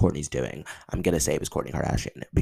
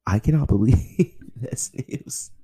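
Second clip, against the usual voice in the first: laughing disbelief; 9 semitones higher, 3 dB louder, slower than usual. Real, not acted. Overwhelmed by the news.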